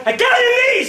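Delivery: very high, very loud yell; furious screamed order. Retold or imitated. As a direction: scream the command at full force. Acted furious order at actor.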